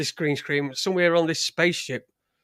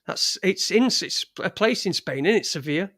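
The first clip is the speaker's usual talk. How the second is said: ordinary talk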